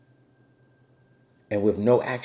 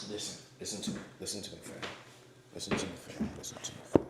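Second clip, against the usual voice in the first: whisper; 5 semitones lower, 15 dB quieter, faster than usual. Real, not acted. Whispering outdoors with camera.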